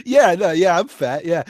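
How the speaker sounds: lightly voiced bullied kid, going along with the joke; a hint of a teased kid laughing it off; bullied kid playing along. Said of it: "It's like the kid who gets bullied".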